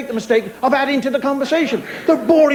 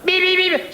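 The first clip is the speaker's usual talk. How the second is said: high, insistent horn beeps; imitated car horn; 6 semitones higher, slower than usual. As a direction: honk on one held note. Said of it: "bang their horns all the time".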